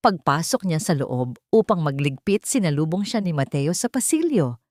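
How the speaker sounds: usual speaking voice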